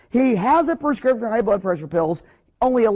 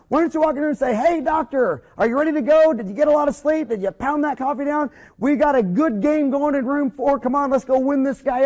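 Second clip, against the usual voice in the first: higher, pumped-up coach energy; pep talk; 6 semitones higher than usual. Shown as a demonstration. Assistant firing up the doctor like a teammate, pumped. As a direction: fire the doctor up before the game. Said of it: "teammates try to fire each other up".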